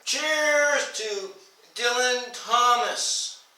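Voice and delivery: high, ringing toast; proclaimed salute to a poet. Real, not acted. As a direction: call it out high as you raise a glass.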